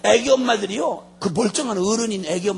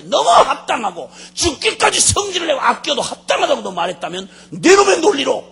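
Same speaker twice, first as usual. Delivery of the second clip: loud, raised divine scolding; thundering rebuke; 5 semitones higher, 7 dB louder than usual. Acted. The Lord turning Jonah's logic against him, thundering. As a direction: drive the accusation home at full voice.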